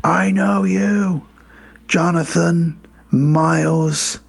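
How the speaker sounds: solemn mock-prophet Santa; biblical Santa. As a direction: intone it with long pauses between phrases. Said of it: "does come across as a bit biblical".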